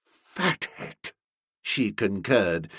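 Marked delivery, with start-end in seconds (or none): 0.0-1.1 soft, high, choked word; strangled voice. A narrator's reading. Nobby agrees with the insult, in a strangled voice. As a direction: squeeze it out through a tight throat. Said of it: "speaking in a strange, strangled voice".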